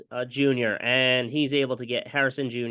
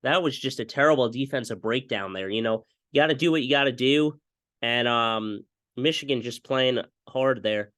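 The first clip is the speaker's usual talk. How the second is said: ordinary talk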